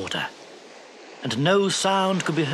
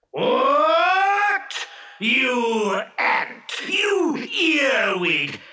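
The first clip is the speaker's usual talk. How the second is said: raging genie; 3 semitones higher, much slower than usual. Acted. Genie rages, threatening to kill.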